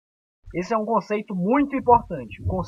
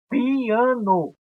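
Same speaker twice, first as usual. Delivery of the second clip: "piano" stretched out as pi, a, no; higher, drawn-out "piano" with each syllable distinct; split into syllables; 4 semitones higher than usual. Shown as a demonstration. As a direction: separate the i and a in "piano". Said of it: "porém em sílabas diferentes".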